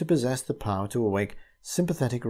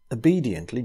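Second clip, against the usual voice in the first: ordinary delivery, nothing marked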